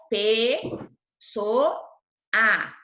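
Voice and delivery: "pe, so, a" split by clear pauses; syllable by syllable. Shown as a demonstration. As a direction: say "pe, so, a" as separate syllables. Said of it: "as vogais estão em sílabas diferentes". ("pe, so, a" sílaba por sílaba, com pausas)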